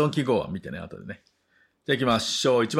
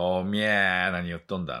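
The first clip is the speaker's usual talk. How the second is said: stretched Nagoya-dialect vowels; quick mock Nagoya accent. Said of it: "名古屋の人が、".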